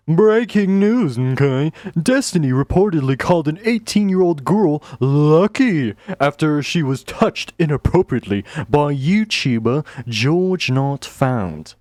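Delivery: drawn-out breaking-news announcer opening; hammed-up news read; mock news report.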